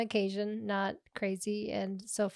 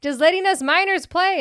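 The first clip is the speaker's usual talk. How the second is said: mock indignation; 9 semitones higher, 11 dB louder than usual. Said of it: "I was like".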